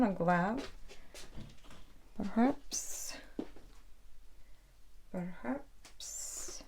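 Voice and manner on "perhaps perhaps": breathy whisper fading away; a private whisper while deciding; whispered musing. Real, not acted. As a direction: think aloud in a hush. Musing to herself while painting.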